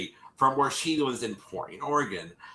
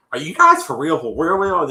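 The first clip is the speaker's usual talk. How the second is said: louder, eager disbelief; amazed excitement; 5 semitones higher, 9 dB louder than usual. Retold or imitated. Girl answering the Catfish hosts, louder, eager disbelief. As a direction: gush like a fan who got the call.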